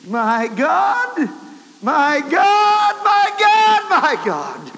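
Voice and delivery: building from loud to high loud scream. Retold or imitated. Preacher slumped on the wheel screams to God, building to a loud cry. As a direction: cry out in anguish, rising each time. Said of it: "As he screamed out".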